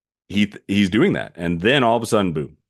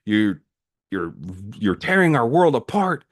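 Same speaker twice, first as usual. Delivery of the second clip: louder, climbing higher into a half-acted yell; imitating a leader's angry yell, mildly raised; mock yell; 5 semitones higher, 4 dB louder than usual. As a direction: scold him like a furious elder, but only half-acted. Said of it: "like, yells at him".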